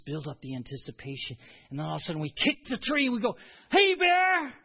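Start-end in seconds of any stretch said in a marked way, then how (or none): 1.7-3.3 fast, rushing into the reveal; rushed build-up. Retold or imitated. To his grandson in the woods, a quick rush. Bear hunt game with grandson. 3.7-4.5 high, loud playful yell; playful shout. Retold or imitated. To his grandson in the woods, a high playful shout. Kicking tree, scaring the bear.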